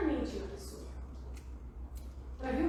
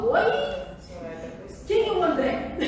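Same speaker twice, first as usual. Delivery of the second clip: loud, raised playful pounce; mock surprise; 3 semitones higher, 5 dB louder than usual. Analyst catching a patient's slip, mock-surprised. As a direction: question it loudly, as if catching them out.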